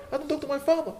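high, whiny mock voice of a sulky child; mocking imitation. Imam mocking a congregant who won't talk to his father, high mocking voice. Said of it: "Can you believe that, Sheikh Omar?".

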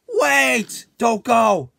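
a high, loud plea; desperate call. Acted. A dying villain calls out to stop the friends from leaving. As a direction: shout after them before they leave.

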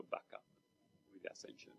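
hushed whispered phrase. Real, not acted. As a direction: let the word fall to a whisper.

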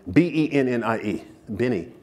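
"B E N N I E" spelled out, then "Benny" with an n sound; correcting the name. Shown as a demonstration. Guest corrects host on grandmother's name, spelling out "Benny". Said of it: "am I not saying that with the proper accent".

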